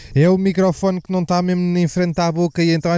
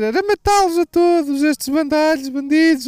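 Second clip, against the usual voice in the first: put-on much higher old woman's voice, fading down; an indignant old lady demanding they be killed; old lady impression; 9 semitones higher than usual. Old woman in the video condemning the bandits, high shrill put-on voice. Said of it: "uma velha a dizer".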